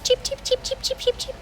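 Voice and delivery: high, tiny chirping cheeps; soft, high cheeping fading to a breath; baby chick cheeps. Acted. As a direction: peep like newly hatched chicks. Baby chicks hatch in the story.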